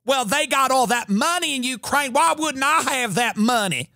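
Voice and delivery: breezy Southern sass; a hint of a sassy Southern lilt. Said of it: "Lindsey Graham talks like that guy".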